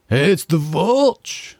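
high, slow frat bro call. Retold or imitated. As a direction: hype up the Volch like a frat bro. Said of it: "a frat boy nickname".

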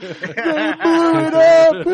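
high, loud, slow despairing yell; hammy anguished shout. Retold or imitated. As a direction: scream it like a tragic movie hero. Acting out the film's ending.

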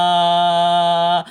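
ordinary delivery

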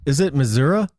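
MISSOURI ending in a questioning "uh"; alternate pronunciation. Shown as a demonstration. Host querying how the local says the state, Missouri ending in "uh". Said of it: "you mean the pronunciation".

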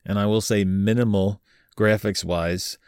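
ordinary talk

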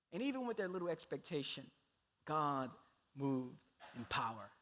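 normal talking voice

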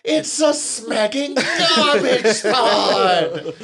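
high, drawn-out yell building in force; hammed-up outraged sitcom outburst; exasperated shout. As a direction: bellow it slow and loud, stretching the last words. Acted outburst quoting the show.

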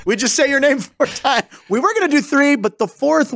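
high, eager mock sales pitch; pleased ad pitchman. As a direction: act out marketers proudly pitching a silly idea. Mock pitch by marketing company.

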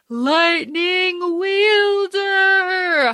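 high, slow, drawn-out announcement; dramatic reveal. Acted. Host hyping up the dragon's power, drawn out grandly. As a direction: stretch it like a big reveal.

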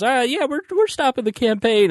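high-pitched mock spokesman voice; Jesse Benton. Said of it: "puts his foot in it and says".

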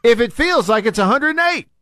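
high-pitched, emphatic protest; exasperated rant. Real, not acted. Radio host ranting at the weatherman about feels-like temperatures, exasperated. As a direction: argue it indignantly, voice riding high.

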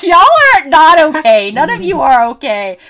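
high, loud mock scolding; exclaiming in amusement. Real, not acted. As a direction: burst out loudly, half laughing at them. Laughing outburst scolding film characters.

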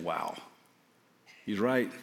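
hushed, choked-up wonder; emotional. Real, not acted. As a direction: barely get the words out, moved. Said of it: "I got all emotional".

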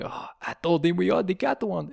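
French taunter. Acted. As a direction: gloat in a hammed-up French accent. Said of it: "insults by the French".

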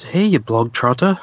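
hammed-up Irish letter-reader; put-on Irish accent. Podcaster acting out a soldier's letter from home, mock Irish accent. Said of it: "I was gonna try to do an accent".

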